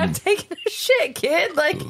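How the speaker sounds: high-pitched, exasperated mock outrage; aggressive exasperation. Parent to a kid sitting in the bathroom, aggressively exasperated. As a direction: plead the obvious at a high, indignant pitch. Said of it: "I'm sorry if that seemed aggressive".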